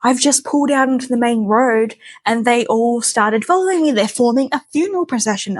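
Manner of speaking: high worried voice, lightly acted; panicky report. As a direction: play the nervous driver reporting bad news. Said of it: "And he's like".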